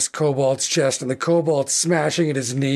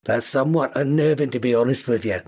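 understated Scouse newspaper seller; Scouse kobold; 3 semitones lower than usual. Acted. Kobold quotes a customer the paper's price, in Scouse. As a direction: haggle matter-of-factly in a Liverpool accent. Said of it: "very thick scouse kobold".

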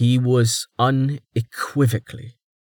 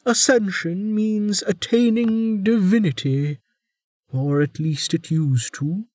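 put-on higher, lecturing old warden; 10 semitones higher than usual. A narrator's reading. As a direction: explain with weary scorn. Warden voiced.

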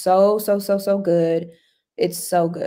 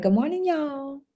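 higher, soft Texas twang; Texas accent; 6 semitones higher, 5 dB quieter than usual. Pretend. Host echoes a viewer's greeting, playful Texas accent. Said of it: "My Texas accent".

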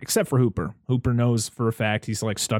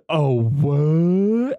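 a light yokel voice, loud and high, with a rising "what"; yokel impression again; 5 semitones higher, 5 dB louder than usual. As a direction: replay the startled local, like a soundboard clip. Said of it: "I want that as on my soundboard".

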